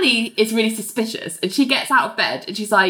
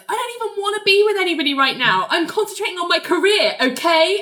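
high, fast, mocking impression of Ali; 10 semitones higher, faster than usual. Host mocks Ali's excuse in a high, fast voice. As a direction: squeak it out like a prim, defensive Ali. Ali defending herself, mocked.

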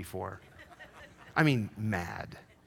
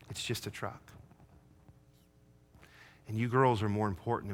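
tender apology; 3 semitones lower, 6 dB quieter than usual. Retold or imitated. Father apologizing to daughters, soft and tender. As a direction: gently say sorry to your girls.

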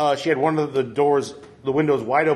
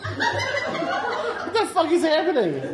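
very high, drawn-out, laughing exasperation; exasperated, cracking up, pitch shooting way up; exasperated disbelief; much slower than usual. Real, not acted. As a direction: squeal it slowly in helpless disbelief. Derailed by endless pizza questions.

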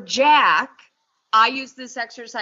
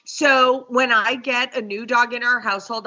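usual speaking voice

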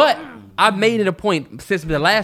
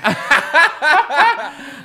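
high laugh; 8 semitones higher than usual. Real, not acted. Cracking up at his friend's doctor joke, high laugh. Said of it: "I'm not gonna mock this man".

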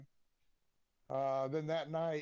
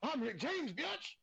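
high, squealing "biatch" at the end, even higher; 10 semitones higher, slower than usual. Retold or imitated. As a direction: repeat the catchphrase with more squeal. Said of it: "I said".

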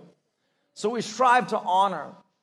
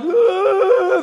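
high loud cry of emotion; a sobbing ugh; mock overcome wail; overwhelmed groan; 12 semitones higher, 9 dB louder than usual. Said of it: "they're like".

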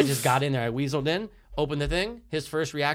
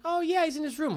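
high, airy imitation of a mom; friend's mom; 12 semitones higher than usual. Mom tells visitor her son is in, high put-on voice. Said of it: "She goes".

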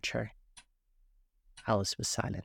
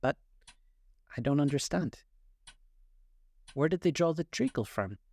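hesitant start, then higher questioning; tentative, halting query; very cautious; 5 semitones higher than usual. A narrator's reading. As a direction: venture it carefully, pausing after the first word. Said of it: "she began very cautiously".

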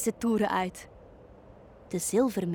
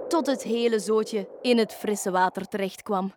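ordinary delivery, nothing marked

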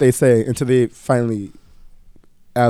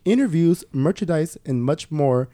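upbeat, higher sponsor-read voice; 5 semitones higher than usual. Acted. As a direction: sell it like a bright promo spot, a notch higher. Host reads a sponsor ad.

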